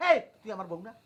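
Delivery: low warning challenge. Acted. Husband asks whose wife she is, low warning.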